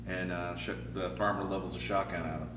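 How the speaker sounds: usual speaking voice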